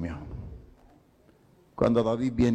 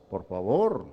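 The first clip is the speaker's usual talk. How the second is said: ordinary delivery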